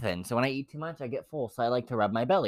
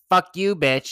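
raised, punchy imitation of a heckler; louder, higher acted cursing; mock street yelling; 7 semitones higher, 7 dB louder, slower than usual. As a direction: throw it out like a passerby's insult. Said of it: "yelling cuss words at you".

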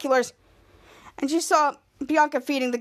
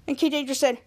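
usual speaking voice

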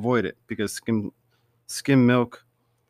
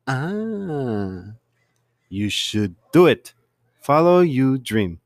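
slow mock Spanish accent; slow, faked Spanish accent giving earnest advice; fake Spanish accent; much slower than usual. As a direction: lay on a put-on Spanish accent, unhurried. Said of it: "he faked that accent".

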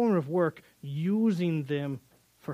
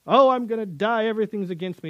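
higher and louder, a hammed-up whiny lament; mock melodramatic Jacob; 4 semitones higher, 5 dB louder than usual. Mocking Jacob's melodramatic complaint.

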